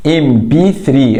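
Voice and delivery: ام بي ثري slowly, each part separate; slow, deliberate ام بي ثري; pronunciation cue. Shown as a demonstration. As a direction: sound out ام بي ثري so the first vowel is heard. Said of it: "النطق هنا ايه؟".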